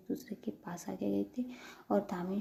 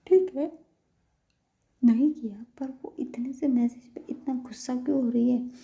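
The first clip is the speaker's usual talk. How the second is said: loud, higher-pitched frustration; exasperated venting; 4 semitones higher, 7 dB louder than usual. A narrator's reading. Employee venting alone about her boss's anger, exasperated. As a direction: burst out in baffled annoyance.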